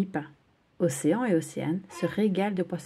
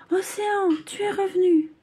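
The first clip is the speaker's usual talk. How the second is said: high, louder joyful surprise; joyful cry; 12 semitones higher, 5 dB louder than usual. Acted. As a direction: cry out in delight at the return. Retrouvailles avec son ami. (cri de joie aigu)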